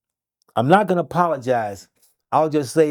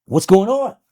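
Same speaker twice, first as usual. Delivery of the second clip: higher, louder question; imitating a dad asking, pitched up; retold worried father; 6 semitones higher, 5 dB louder than usual. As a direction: retell an anxious father's question. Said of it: "He said".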